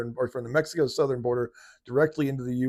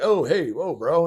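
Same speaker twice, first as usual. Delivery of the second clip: higher, louder startled burglar; mock startled intruder; 6 semitones higher, 7 dB louder, slower than usual. Burglar caught at the door, mock startled. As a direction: play the caught burglar backing off. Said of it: "And they're like".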